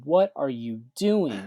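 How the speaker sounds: can't believe what he's seeing; total disbelief. Retold or imitated. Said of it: "in total disbelief".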